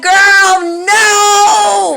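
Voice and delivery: high loud "no"; mock shocked cry. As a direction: cry out in disbelief. Sister's shocked reply.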